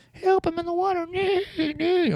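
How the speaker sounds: very high luring water horse cry; Kelpie impression. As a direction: shriek high like a drowning lure. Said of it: "that's my Kelpie impression".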